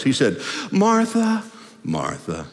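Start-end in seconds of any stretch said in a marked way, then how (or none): 0.7-1.4 gentle chiding call, drawn out and lifted in pitch; Jesus. Acted. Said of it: "he may have said it like this". 1.8-2.5 low, hushed, tender reproach; Jesus. Acted. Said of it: "he may have said it like this".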